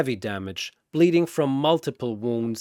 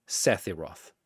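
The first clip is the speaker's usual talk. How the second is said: plain reading voice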